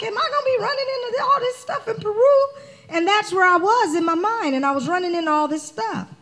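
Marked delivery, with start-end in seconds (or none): 0.0-2.5 worried. Retold or imitated. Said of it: "I'm like".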